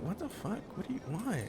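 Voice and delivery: hushed, halting mock bewilderment; soft imitation of a stunned room; appalled disbelief. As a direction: react under your breath, aghast. Acting everyone's appalled reaction to Andy.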